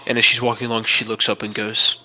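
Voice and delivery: ordinary delivery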